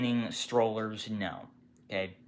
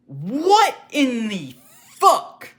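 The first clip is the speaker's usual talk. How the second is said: slow, drawn-out yell of disbelief; shocked shout; 11 semitones higher, 8 dB louder, much slower than usual. Real, not acted. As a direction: bellow it in shock. Shocked outburst at the bathroom noise.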